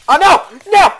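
high, loud screams of dismay; screaming. Real, not acted. Gamer failing on the retry, screaming in dismay. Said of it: "Will you stop screaming like that".

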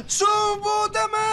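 high shocked yell, stretched out; startled shout. Real, not acted. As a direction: cry out in shock. Startled by a sudden monster.

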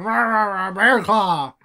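mock mouthful of pastry; imitating eating while talking. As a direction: act as if chomping a bear claw. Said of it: "having her eating a bear claw".

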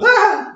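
very high, short mock shriek of fright; fright scream. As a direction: shriek at the spider. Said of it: "the natural reaction of".

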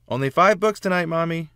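put-on high, loud begging little girl; pleading child. Olivia bargaining at bedtime.

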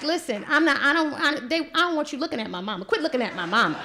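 loud, fast scolding of the guards; angry kid scolding. Retold or imitated. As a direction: tell the watchers off for staring at mom. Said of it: "I'm like".

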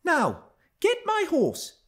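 put-on high, slow girlish command; imperious young girl. A narrator's reading. Young Cathy ordering her cousin like a stable boy, high imperious voice. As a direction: order him like a servant, in a child's voice. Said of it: "as she would of one of the stable boys".

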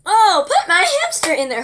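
hint of a child happily playing; playing a kid. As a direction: act out a little kid at play. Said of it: "literally imagine a kid just kind of".

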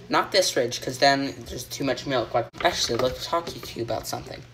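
normal talking voice

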